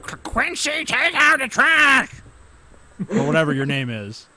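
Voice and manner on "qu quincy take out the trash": shrill mom calling out; yelling mom. Retold or imitated. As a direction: yell it like an angry mother. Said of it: "your mom was like".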